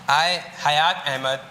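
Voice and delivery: ordinary talk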